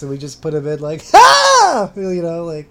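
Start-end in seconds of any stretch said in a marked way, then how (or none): 1.1-1.9 sudden high, loud shout; random hype shout. Shown as a demonstration. Co-host demonstrating shouts to edit into the podcast, loud shout. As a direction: burst out with a hype-man shout. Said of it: "hundred random shouts".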